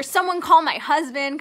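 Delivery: retold yell. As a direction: imitate yelling an order at your sister. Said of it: "I'm yelling at my sister".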